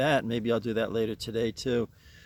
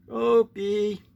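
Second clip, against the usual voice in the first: calling the pet; 8 semitones higher, 5 dB louder than usual. Real, not acted.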